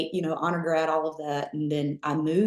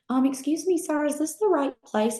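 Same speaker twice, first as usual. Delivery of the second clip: high, timid little voice asking sir; shy new recruit asking, pitched up high; timid question; 10 semitones higher than usual. Retold or imitated. Recruit timidly asks the drill sergeant, high and unsure.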